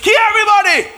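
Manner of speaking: high, loud hammed-up order; preacher mocking Abraham killing everyone; mock frenzied shout. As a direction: bark it like a zealot gone wild.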